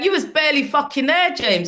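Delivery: high, loud teasing jab; mock accusation. Real, not acted. Comic ribbing the host James, high and loud. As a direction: rib him.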